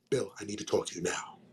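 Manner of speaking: low, very quiet plea; hushed urgency. Retold or imitated. Stoned James pulling his friend aside, hushed and low. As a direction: drop it low and soft, confiding.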